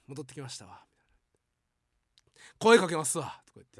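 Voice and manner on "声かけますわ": loud. Retold or imitated. Junior announcing he'll approach.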